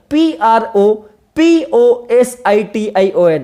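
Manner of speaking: slow letter-by-letter spelling of प्रपोजिशन; dictating letters to students. Shown as a demonstration. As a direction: spell each letter slowly and clearly. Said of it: "ये शब्द आपको याद रखना है".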